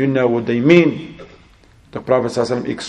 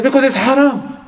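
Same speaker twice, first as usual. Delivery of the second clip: high-pitched, indignant protest; acted outraged retort; 12 semitones higher than usual. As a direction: mimic an exasperated father objecting. Angry father retorts in retold quarrel.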